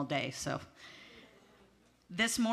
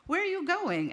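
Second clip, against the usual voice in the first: much higher, sing-song question; lightly voiced curious hairdresser; hairdresser asking; 11 semitones higher than usual. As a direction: pop the question up high like a chatty stylist. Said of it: "She goes".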